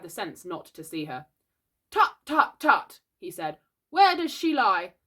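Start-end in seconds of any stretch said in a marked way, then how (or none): 1.9-3.0 high, loud tutting; self-important, high-pitched clucking; pretend doctor. A narrator's reading. 3.9-4.9 high, loud inquiry; boy's puffed-up doctor voice; pretend doctor. A narrator's reading.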